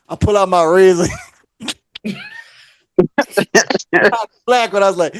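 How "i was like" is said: ordinary delivery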